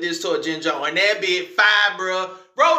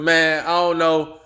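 ordinary talk, nothing marked

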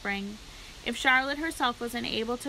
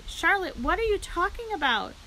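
high-pitched, louder cry of dismay; alarmed cry; 8 semitones higher, 4 dB louder than usual. A narrator's reading. Pig questioning his dying friend, crying out in alarm. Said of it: "he cried".

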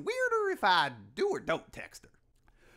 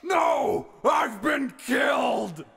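higher, loud, slow hammed-up wail; mock death cry; 5 semitones higher, 4 dB louder, much slower than usual. Manly Dan asked if he's good, hammed-up cry. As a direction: overact a dramatic dying cry.

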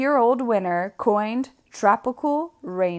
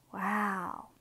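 a soft, much quieter breath of wonder; hushed wow; 3 semitones lower, 12 dB quieter than usual. Acted. The reader marvels at the giant mold, a hushed wow. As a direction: let the wonder slip out under your breath.

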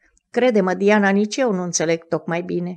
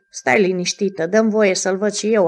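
usual speaking voice

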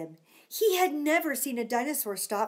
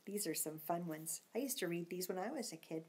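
whispered aside; quick whispered aside to the listeners; 4 semitones lower, 11 dB quieter than usual. Real, not acted. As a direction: hush it like a secret. Aside while reading to kids.

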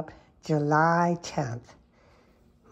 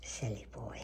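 softer, hushed whisper; 5 semitones lower, 13 dB quieter than usual. Real, not acted. Owner whispers teasingly to her cat. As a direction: breathe it fondly at the cat.

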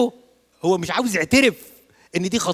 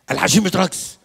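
الحشيش louder and higher, stubborn insistence; insistent shout; 5 semitones higher, 8 dB louder than usual. Retold or imitated. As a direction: raise it, won't budge. Husband insisting louder in argument. (زوج مصمم بصوت عالي)

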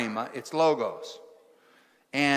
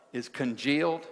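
ordinary talk

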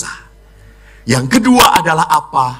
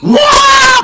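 high loud wailing cry; mock child wail; 6 dB louder than usual. Priest imitates a child wailing at a sad retreat prayer, loud mock cry. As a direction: let out a child's sobbing wail.

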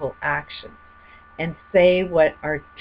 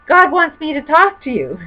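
excited retelling; 10 semitones higher, 5 dB louder than usual. Retold or imitated. Retelling the lawyer's startling words.

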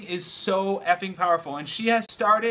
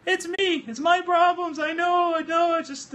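imitating his friend; 10 semitones higher than usual. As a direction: squeak out her self-blame, near shouting. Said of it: "I'll do it in her voice".

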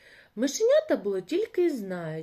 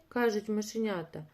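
usual speaking voice

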